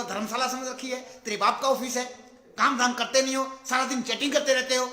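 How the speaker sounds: loud, scolding rant at a lazy worker; angry scolding. Retold or imitated. Boss scolding his slacking employee, loud angry rant. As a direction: bark it out like a fed-up boss. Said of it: "तो बोला".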